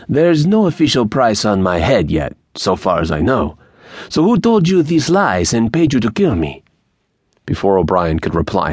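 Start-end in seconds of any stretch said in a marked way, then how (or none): 0.1-6.6 put-on high, animated accented bandit demanding answers; insistent Mexican bandit. A narrator's reading. Bandit demands to know who paid O'Brien, insistent. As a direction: press hard, pitch leaping up. Narrator voices Angel pressing captive.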